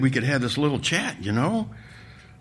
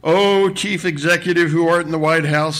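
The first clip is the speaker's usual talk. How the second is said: mock-pious invocation, raised and loud; loud monotone; 5 semitones higher, 5 dB louder than usual. A narrator's reading. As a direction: intone it like a pompous prayer. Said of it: "a loud monotone".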